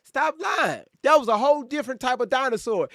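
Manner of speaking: high, accusing stop lying; loud callout. Real, not acted. Streamer accusing a chatter of lying, high yell.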